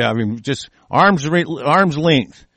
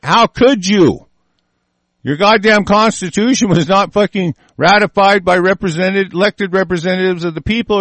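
usual speaking voice

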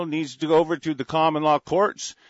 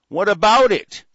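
loud, higher defiant challenge; 5 semitones higher, 7 dB louder than usual. Real, not acted. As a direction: throw it down as a dare. Angry rant challenge.

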